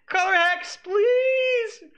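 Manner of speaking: very high, loud pleading wail; exasperated plea. Real, not acted. Streamer begging a player on screen, loud exasperated wail. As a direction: wail at him to stop.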